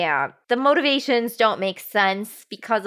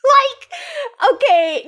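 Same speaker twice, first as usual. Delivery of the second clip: laughing cry; 13 semitones higher, 3 dB louder, much slower than usual. Real, not acted. Laughing disbelief at scene.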